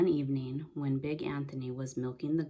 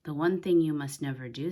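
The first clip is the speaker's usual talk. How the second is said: louder, stern grandma witch warning; 8 dB louder than usual. A narrator's reading. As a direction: lay down the one strict rule. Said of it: "said Stregonona".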